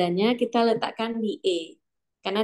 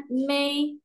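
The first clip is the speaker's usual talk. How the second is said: May held high and level; first tone; 6 semitones higher than usual. Shown as a demonstration. Tone mnemonic for students, first tone. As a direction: stretch May on a high, even pitch. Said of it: "nada satu".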